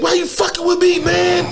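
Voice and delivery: high, loud yell of outrage; retold angry yell. One-eyed friend yelling at the man, high loud shout. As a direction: shout it like he's squaring up.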